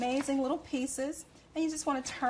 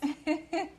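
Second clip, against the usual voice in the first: a bright little laugh, pitched up; happy laugh; 5 semitones higher than usual. Real, not acted. Cook laughing at her cake joke, a bright little laugh. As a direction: laugh with pleasure at your own line.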